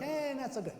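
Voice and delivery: high, softish mock-noncommittal voice; vague dismissal. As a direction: imitate a vague, unimpressed instructor. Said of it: "make a general statement like".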